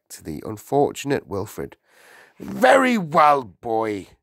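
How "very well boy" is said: loud, high, then dropping; bullying headmistress turning on a pupil; domineering headmistress. A narrator's reading. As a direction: command the boy's attention. Narrator voices the Trunchbull.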